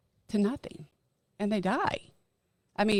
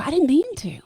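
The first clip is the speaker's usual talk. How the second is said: higher, louder mock-whiny kid excuse; kid's excuse; 8 semitones higher, 8 dB louder than usual. Mimicking a kid who just broke something, whiny and loud. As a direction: whine it like a caught child.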